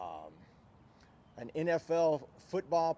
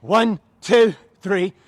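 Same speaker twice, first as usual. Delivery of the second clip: loud drill-style cadence; shouted count; 4 semitones higher, 15 dB louder, slower than usual. Real, not acted. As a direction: shout the numbers in steady rhythm. Trainer calls out exercise count.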